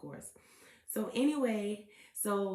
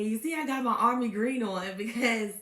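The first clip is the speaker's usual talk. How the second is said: louder, laughing through the words; 3 semitones higher, 7 dB louder than usual. Real, not acted. Host laughs showing off her army green outfit. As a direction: giggle while you brag a little.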